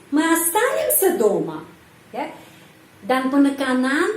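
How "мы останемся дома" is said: останемся lifted, дома falling after it; stress in main clause. Shown as a demonstration. Teacher showing question intonation in the main clause, stress on останемся. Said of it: "penekanan di sini dalam klausa utama".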